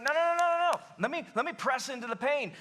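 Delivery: acted-out eager objection; insistent interruption. Acting Jesus refusing to back off.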